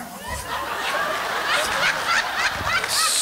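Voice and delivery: long high wheezing laugh. Real, not acted. Comedian cracking up at the CPR joke, wheezing high. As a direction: crack up with the crowd.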